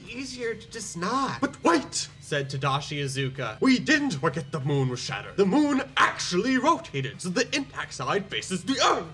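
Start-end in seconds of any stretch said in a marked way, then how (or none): 1.4-2.1 a hint of a Bernie Sanders accent, pitched up on wait; light Bernie Sanders impression, cutting in. Iizuka cuts in to defend the moon, lightly in a Bernie Sanders accent. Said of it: "I gave Tadashi Iizuka the Bernie Sanders accent". 3.6-9.1 put-on Bernie Sanders accent. Iizuka explains the moon rotated, in a Bernie Sanders accent. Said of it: "I gave Tadashi Iizuka the Bernie Sanders accent".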